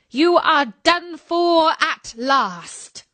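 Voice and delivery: high, slow gloating witch; wicked woman's drawn-out triumph; triumphant wicked queen. Acted. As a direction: savor the victory slowly and cruelly.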